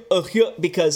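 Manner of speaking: usual speaking voice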